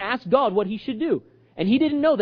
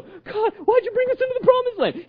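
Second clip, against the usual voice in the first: high-pitched whine to God; whining complaint. Acted. Joshua complaining to God after the defeat, whining. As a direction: plead in a whiny, raised voice. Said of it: "Stop whining!".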